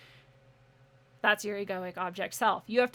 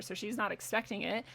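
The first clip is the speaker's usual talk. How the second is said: ordinary delivery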